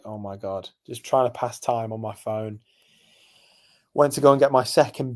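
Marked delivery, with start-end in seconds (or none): 0.0-0.7 low, hushed retold despair; bored dismay. Alone watching football in the pub, bored dismay. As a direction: let it sink, quiet and fed up.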